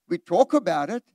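ordinary talk, nothing marked

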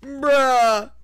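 a real outburst of disbelief; loud bruh. Real, not acted. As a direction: blurt it out in disbelief. Reacting to own joke.

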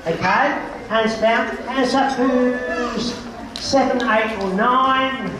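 slow and deliberate. Real, not acted. Slowly counting ages for kids.

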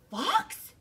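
high, shocked one-word question. Acted. Hare hearing Bear trusted Fox, shocked. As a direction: gasp the name in shock. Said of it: "gasped Hare".

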